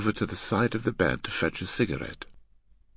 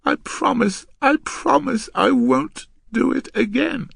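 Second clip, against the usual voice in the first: very high, loud pleading; begging girl; 6 dB louder than usual. Acted. Girl promising the man she will behave, begging. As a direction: beg in a high girlish voice.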